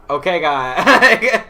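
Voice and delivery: drawn-out words breaking into laughter; awkward hint. Retold or imitated. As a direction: stretch it out, then crack up.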